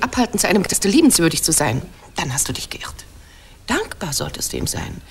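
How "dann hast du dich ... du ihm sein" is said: breathy whisper, much softer; whispered warning. Acted. Penelopeia warns Odysseus in a low whisper. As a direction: hiss the threat under the breath. Wife warning husband, drops voice.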